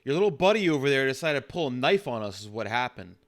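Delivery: loud officer telling off a suspect; irritated police officer. Acted. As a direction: throw it hard at the back seat. Player acts arresting officer answering.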